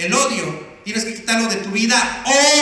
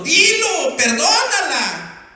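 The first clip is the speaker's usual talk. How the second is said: loud, raised mock-pastor order; insistent command; 7 semitones higher, 6 dB louder, slower than usual. Pastor urging a man to forgive his wife, insistent. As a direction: press him firmly, stretching the last word.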